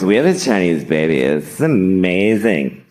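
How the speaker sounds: hint of a self-satisfied new parent, low; lightly voiced bragging parent, drawn out at the end; mock smug party chat; cocktail party parents. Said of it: "they go to cocktail parties".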